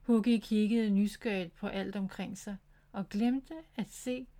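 ordinary delivery, nothing marked

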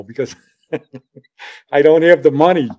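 retold admission, laughing through it; laughing confession. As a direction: break into a laugh mid-sentence. Laughs while retelling his confession.

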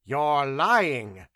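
loud, sharp inquisitor; angry, forceful accusation; snapped accusation. A narrator's reading. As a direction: snap it at the suspect. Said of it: "snapped Demetrio".

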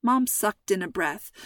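ordinary delivery

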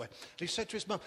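usual speaking voice

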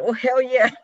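high, loud triumphant hell yeah; thrilled cheer. Retold or imitated. About to kiss the woman, high loud thrilled cheer. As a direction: celebrate like you just won. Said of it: "at that moment I said".

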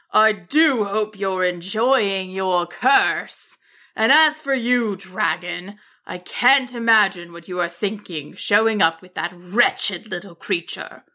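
lightly voiced snarling wizard, dripping disdain; scornful wizard. Wizard scorns sheep and dragon for showing up, scornful. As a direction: sneer at the intruders as a cross wizard.

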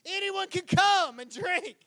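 high, slow call to a crowd; stretched-out, high-pitched shout; shouted invitation. Acted. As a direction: proclaim it to the far back. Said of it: "Now he's shouting".

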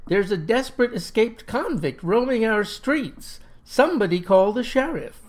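alarmed shout. Acted. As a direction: call out to the whole street. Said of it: "I cried".

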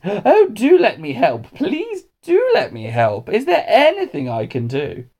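pleading, high, drawn-out gushing; over-eager helper. A narrator's reading. Hamish badgering the dinner ladies to let him help, over-eager. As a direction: beg to help, stretching the words high.

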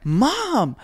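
high, loud embarrassed cry; embarrassed shout at mom; embarrassed yell. Retold or imitated. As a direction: call out to mom, mortified. Embarrassed son calls out.

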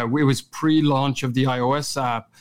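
ordinary talk

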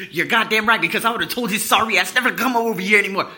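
imitated mom's loud tirade; fast, high, loud ranting; angry. Mom banning the friend from the house, angry. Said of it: "She was angry for a good thirty minutes".

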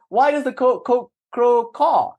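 put-on high, slow, stammering child; a little boy questions the scholars, high and halting. Boy asks the pandits.

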